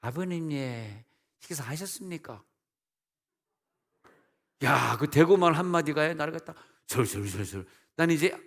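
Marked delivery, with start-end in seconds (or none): 0.0-2.4 put-on soft, lilting Daegu accent; Daegu daughter-in-law. Daughter-in-law asking father-in-law if he has eaten, soft Daegu accent. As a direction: gently imitate a polite Daegu greeting. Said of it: "우리 며느리가 대구에서 왔거든요".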